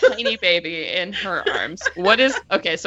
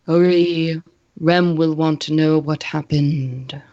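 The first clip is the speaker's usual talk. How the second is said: lightly voiced Vlad. Game master voices gliding, quiet Vlad.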